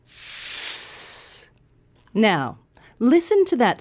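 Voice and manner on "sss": long, soft hissing sss; snake hiss. Shown as a demonstration. Teacher imitates a hissing snake, long sss. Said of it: "a snake makes when it hisses".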